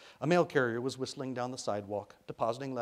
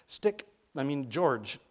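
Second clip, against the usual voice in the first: louder, urgent call, slowed; cried out; 3 semitones higher, 6 dB louder, much slower than usual. A narrator's reading. Rennie uneasy, calling to George, cried out. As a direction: call out urgently, correcting the name. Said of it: "he cried".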